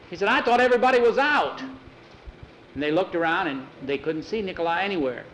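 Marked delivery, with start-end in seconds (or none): 0.3-1.6 high, quick, worried outburst; alarmed protest. Acted. Finding Nikolai missing, alarmed outburst. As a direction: blurt it high in dismay.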